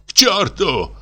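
high teary cry; weeping dismissal; tearful shout. A narrator's reading. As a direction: cry out near tears. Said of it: "крикнул он плачущим голосом".

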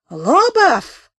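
high, loud bellowed name; thunderous shout. A narrator's reading. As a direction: roar the name across the platform. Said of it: "закричал он громоподобно".

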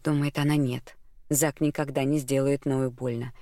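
ordinary delivery, nothing marked

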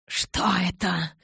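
whispered hiss of irritation. Acted. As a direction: hiss it through the teeth. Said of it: "прошипел Кай".

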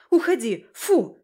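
loud, urgent shooing shouts; forceful frightened commands; driving it away. A narrator's reading. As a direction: shout to chase off a beast. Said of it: "Я попыталась прогнать волка".

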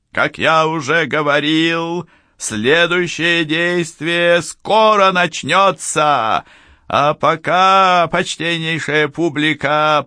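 put-on high, theatrical old-school showman; booming circus ringmaster. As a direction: announce it grandly to the audience. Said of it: "особую театральную интонацию загримированного конферансье или иллюзиониста старой закалки".

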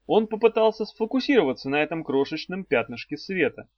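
ordinary delivery, nothing marked

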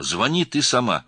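high, loud insistence; urgent. A narrator's reading. Girl insisting after crying out.

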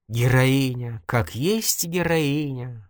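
slow, drawn-out weary praise; resigned mock admiration; sighing irony. A narrator's reading. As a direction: sigh it out, stretching the words. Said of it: "вздохнул журналист".

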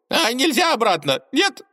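high squeaky yelling gopher. A narrator's reading. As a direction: shriek it like a desperate rodent. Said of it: "орал суслик".

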